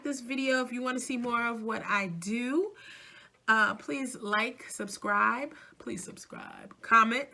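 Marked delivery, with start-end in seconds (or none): 5.8-6.7 quiet, breath-only aside; hushed plea. Real, not acted. Asking viewers to subscribe, in a hushed aside. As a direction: drop to a soft near-whisper.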